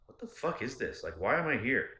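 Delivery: retelling a birthday fight, exasperated mock yell; retold angry outburst. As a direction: throw up your hands, why am I here.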